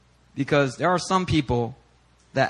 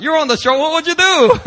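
higher, louder game-show host call; spring the surprise like a TV host; host reveal; 12 semitones higher, 8 dB louder than usual. Retold or imitated. Preacher playing the TV host who surprises bystanders, loud announcer reveal. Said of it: "John Quinones comes out".

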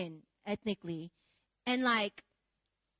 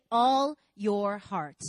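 ALL YOUR HEART drawn out slowly and louder; call and response; 6 dB louder, much slower than usual. Shown as a demonstration. Preacher leading the congregation, slow and emphatic. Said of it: "Everybody say".

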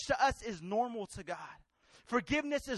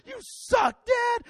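put-on high, loud kid shouting at his dad; childish yell; 11 semitones higher, 5 dB louder, slower than usual. Young son yelling at his dad, high shout. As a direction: yell like a furious little boy. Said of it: "yelling at my dad".

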